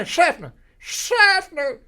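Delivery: very high, very loud shout of "Seffner". Retold or imitated. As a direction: holler SEFFNER at the top of your lungs. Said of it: "Say it to the top of your lungs".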